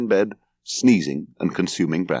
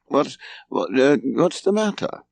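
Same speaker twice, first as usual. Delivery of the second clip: groggy, higher-pitched, stumbling waking voice; sleepy; 5 semitones higher than usual. A narrator's reading. Son shaken awake by his mother, sleepy and confused. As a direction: stumble over the words, half awake. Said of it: "sitting up sleepily".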